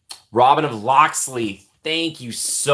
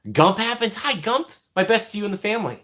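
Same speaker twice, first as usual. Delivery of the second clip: much higher, playful character greeting; put-on Gump voice; 12 semitones higher than usual.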